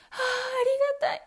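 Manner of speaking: high, fast, glad cry; wife overjoyed that help is coming; joyful relief. Acted. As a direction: burst out in relief. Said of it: "と奥さんは嬉しそうに言いました".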